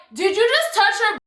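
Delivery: high-pitched retold challenge; said loud. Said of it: "And I said it loud".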